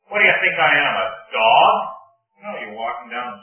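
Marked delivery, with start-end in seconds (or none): 0.1-1.7 much louder, higher giant bellowing; furious giant taunt; Goliath's roar. Retold or imitated. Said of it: "And Goliath".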